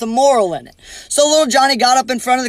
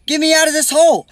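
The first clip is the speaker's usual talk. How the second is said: mock yell; 6 semitones higher than usual. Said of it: "he yells at the horse".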